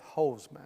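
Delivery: "hoseman" said with a long o, as in fire hose; "hoseman", first syllable rhyming with "goes"; hose-like pronunciation. Shown as a demonstration. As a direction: make "hoseman" start like "hose". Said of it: "I would pronounce it like the".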